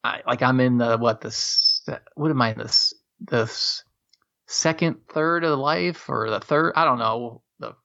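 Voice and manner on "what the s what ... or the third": normal talking voice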